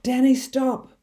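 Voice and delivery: high, loud urgent warning; shouting. A narrator's reading. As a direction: shout to stop him before he falls. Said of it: "he shouted".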